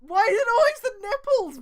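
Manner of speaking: high, loud, incredulous complaint; exasperated mock rant. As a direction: throw up your hands at the writer.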